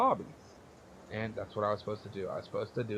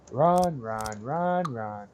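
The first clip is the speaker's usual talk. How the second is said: louder sing-song yell of run; yelled taunt; 7 dB louder, much slower than usual. Retold or imitated. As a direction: call it out teasingly from the bathroom. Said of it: "repeatedly yelling out from the bathroom".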